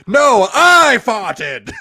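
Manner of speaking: hammed-up heroic confession, slow and loud; slow proud declaration. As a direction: proclaim it like a movie hero standing up. Parody of I'm Spartacus.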